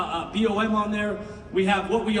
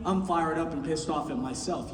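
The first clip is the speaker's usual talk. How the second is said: much lower and softer, subdued; admitting to the crowd, dropped low and soft; quiet admission; 7 semitones lower, 6 dB quieter than usual. Real, not acted. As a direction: say it under your breath. Quiet confession after shouting.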